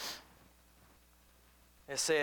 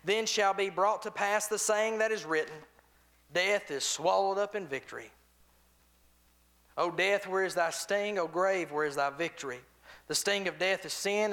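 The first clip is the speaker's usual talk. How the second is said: raised, louder solemn Bible reading; scripture reading; 4 semitones higher, 4 dB louder than usual. Acted. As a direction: proclaim the verses to the church. Reads scripture aloud to congregation.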